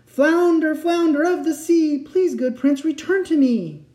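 very high, loud sustained call; shouting. A narrator's reading. Fisherman calling the flounder through thunder, shouting. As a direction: shout it over a raging storm. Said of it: "He could hardly hear himself shout".

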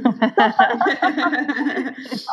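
sustained amused laughter; real laugh. Real, not acted. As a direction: laugh out freely, rising well above speaking pitch. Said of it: "I'm laughing 'cause".

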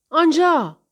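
high, loud call; shout. A narrator's reading. Harry spotting an escape through the crowd, sudden shout. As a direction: shout it out to point the way. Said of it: "فریاد زد".

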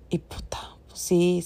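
breathless whispered swear; moved mom whispering as it hits her; whispered curse. Real, not acted. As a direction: breathe the curse out, barely voiced. Emotional aside, whispered swear.

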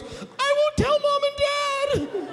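little sister. Retold or imitated. Little sister to brother in smoky van, hammed-up high squeal. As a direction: squeal it like a terrified small child. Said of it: "little sister with a big beady eye turns around".

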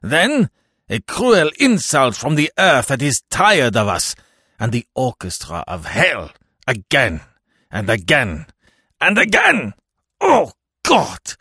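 rising desperate plea of the damned; wailing lament. A narrator's reading. Jean-Marie cries out against purgatory, building to wails. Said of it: "cried the voice of Jean-Marie".

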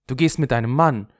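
high, loud, upset outburst; tearful accusation. A narrator's reading. Henri realises Lulu leaves with a man, tearful outburst. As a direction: accuse her, upset and close to tears. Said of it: "sagte Henri weinend".

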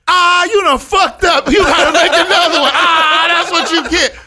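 high, loud, laughing taunt; mocking friends laughing. Friends jeering at the cook's ruined taco, loud and laughing. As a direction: cackle it at him like a heckler.

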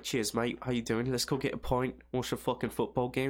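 casual London lad impression; mock London accent. Londoner inviting a mate for a pint, mock London accent. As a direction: put on a matey London accent. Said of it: "you go to London, they're like".

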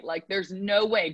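same words again, slowed right down; slowed emphasis. Shown as a demonstration. Coach repeating to the athlete, slow and firm. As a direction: drag it out slowly to drive it home.

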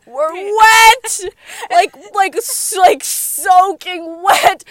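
high, loud, drawn-out disgust; loud, slow wail of revulsion; disgusted outcry. Real, not acted. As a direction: cry out in appalled disbelief. Reliving a disgusting wet kiss.

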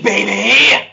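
loud high wrestler shout; booming wrestler boasts. Acted. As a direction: yell it like a wrestling promo. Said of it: "I'll do it in my best wrestler voice".

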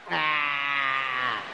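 hammed-up low yelp of being trapped; a dad's pretend cry of capture; mock caught cry. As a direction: play caught in the web. Dad plays caught in web.

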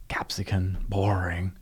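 breathy unvoiced whisper of a dull food. Real, not acted. Speaker listing boring food, whispering. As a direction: whisper it like a letdown.

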